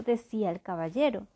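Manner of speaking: ordinary delivery, nothing marked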